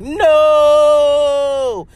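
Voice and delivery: sudden loud, high exclamation; emphatic no. Real, not acted. Answering her own question with a loud, high no. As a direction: blurt the no out big.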